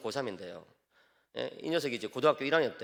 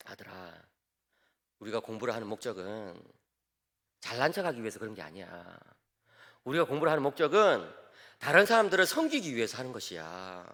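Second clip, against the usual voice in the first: earnest fatherly advice; 5 semitones lower than usual. Retold or imitated. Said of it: "조금 더 진지하게 이야기를 했죠". (아들에게 차분히 타이르는 아빠)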